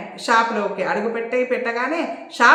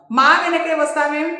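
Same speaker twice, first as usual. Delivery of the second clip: higher, louder angry snap; flaring up; 6 semitones higher, 4 dB louder than usual. A narrator's reading. Saroja rounding on the man trailing them, fiery anger. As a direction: flare at him for following. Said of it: "అంది సరోజ మండిపడుతూ".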